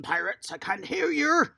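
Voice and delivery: ordinary talk, nothing marked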